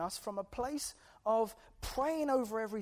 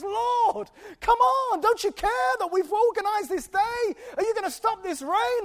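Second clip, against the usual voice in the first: much higher, loud shout to God; shouted prayer; 11 semitones higher, 12 dB louder than usual. Retold or imitated. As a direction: shout the prayer over the rain. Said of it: "Pastor Paul gets up in the middle and says".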